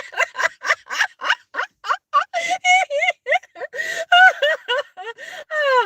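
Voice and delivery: long, high-pitched helpless laugh; real uncontrollable laughter, running on and on; laughing fit. Real, not acted. Finds it absurd they don't know each other, laughing fit. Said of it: "I started to laugh".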